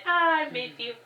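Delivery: soft, sighed into the bed; quiet sigh. Acted. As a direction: breathe it out quietly. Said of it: "Like, she said".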